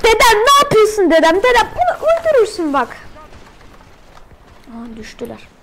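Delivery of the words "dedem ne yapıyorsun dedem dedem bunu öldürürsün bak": calling out. Real, not acted. Calling to teammate grandpa.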